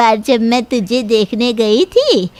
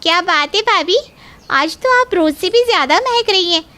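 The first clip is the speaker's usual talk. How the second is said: gushing sister-in-law; 7 semitones higher, faster than usual. Acted. Naina flattering Nanda.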